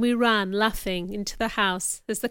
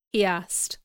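plain reading voice

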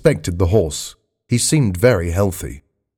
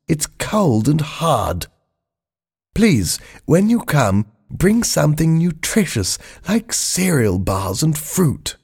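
higher, plaintive son's voice; Charles writing from prison; 6 semitones higher than usual. Acted. Charles pleading with his mother from his cell, higher. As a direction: read the letter as a mournful grown son.

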